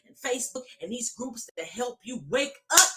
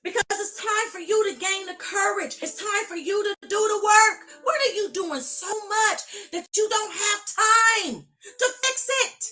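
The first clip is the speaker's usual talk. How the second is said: preaching at full force; 7 semitones higher, 4 dB louder than usual. Real, not acted. Preaching climax.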